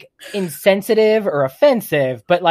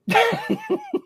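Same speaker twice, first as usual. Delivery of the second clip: high giggle; 5 dB quieter than usual. Real, not acted. Laughing at own innuendo.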